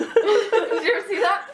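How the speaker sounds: laughing through the question; slow, laughing question. Real, not acted. Laughing while asking friend.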